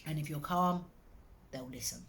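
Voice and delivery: ordinary delivery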